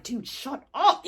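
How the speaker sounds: slow, laughing retold "shut up"; laughing heckle. Bystanders behind him mocking his story, laughing heckle. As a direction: say it through a laugh, mock-exasperated.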